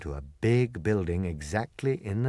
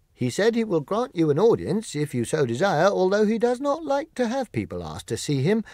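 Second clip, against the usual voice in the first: higher, louder soldier reporting eagerly; soldier's report; 8 semitones higher, 4 dB louder than usual. Acted. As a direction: rattle off the news with rising excitement. Soldier reports Oz's reply.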